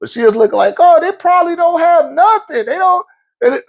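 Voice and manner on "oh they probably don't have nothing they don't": high pitying mock wife voice. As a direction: mimic her feeling sorry, pitched way up. Mocking wife's pity.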